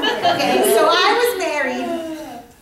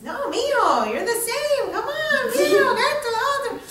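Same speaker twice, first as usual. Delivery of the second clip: high, swooping teasing protest; needling friend; 11 semitones higher, 3 dB louder than usual. Acted. Friend from Hialeah goading the zen man, high teasing. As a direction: swoop up high in mock disbelief.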